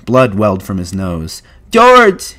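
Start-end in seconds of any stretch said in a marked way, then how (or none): 1.7-2.3 high, loud cry for help; panicked wail; terrified cry. A narrator's reading. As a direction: call out in terror to your protector. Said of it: "he cried".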